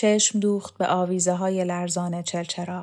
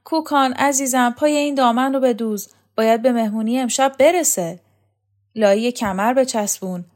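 mock sweet customers; 5 semitones higher, 5 dB louder than usual.

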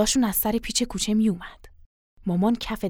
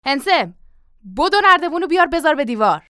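loud, raised command; shouted order; 4 semitones higher, 5 dB louder than usual. Acted. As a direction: call out an urgent order. Father calls out an order.

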